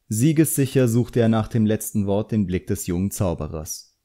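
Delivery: ordinary delivery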